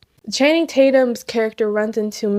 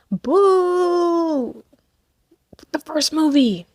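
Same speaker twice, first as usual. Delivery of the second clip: higher, drawn-out playful boo; mock boo; 5 semitones higher, much slower than usual. Real, not acted. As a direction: boo it like a heckler. Booing the first movie.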